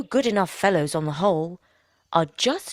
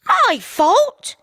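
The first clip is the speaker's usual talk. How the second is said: very high, loud scornful retort; 8 dB louder than usual. A narrator's reading. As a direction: fling the blame back. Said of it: "retorted his wife scornfully".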